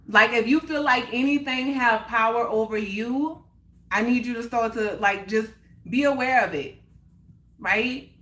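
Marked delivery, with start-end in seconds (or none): none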